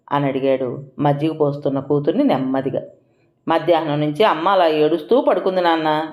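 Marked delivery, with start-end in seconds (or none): none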